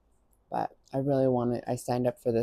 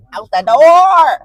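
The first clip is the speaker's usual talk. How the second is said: loud; 12 semitones higher, 21 dB louder, much slower than usual. Real, not acted. Urging herself out.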